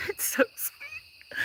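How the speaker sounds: very high, whispered and slow; tiny awed whisper at the sweet gift; breathless whisper. Real, not acted. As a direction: squeak it out, overwhelmed and touched. Moved, whispered squeal.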